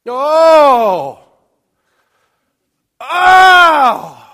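loud high wailing cry; hammed-up birth scream; mock labor cry. Illustrating a woman in labor.

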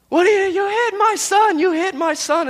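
high, loud, frantic yell at the driver; retold frantic scream. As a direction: scream it like a panicked mother whose child was just hit. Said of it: "began screaming at this woman".

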